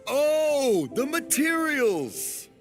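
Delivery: high, slow dawning realization; light up as it finally clicks; sudden realization. Acted. Finally understanding.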